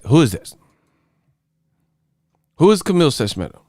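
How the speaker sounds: ordinary talk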